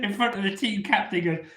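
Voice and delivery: talking through real laughter; barely holding it together while retelling; high laughing narration. Real, not acted. Laughing while telling the story.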